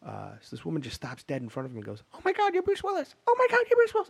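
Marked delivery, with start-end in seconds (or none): 2.2-4.1 shrill-high, louder starstruck squeal; freaking out. Retold or imitated. Woman in theater spots Bruce Willis, high excited squeal. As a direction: imitate a fan losing it over a celebrity. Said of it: "she's just freaking out".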